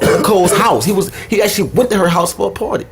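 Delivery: ordinary delivery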